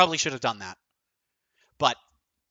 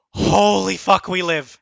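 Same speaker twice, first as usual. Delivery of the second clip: excited shout; 4 semitones higher, 9 dB louder, slower than usual. Real, not acted. Relief after surviving the turn.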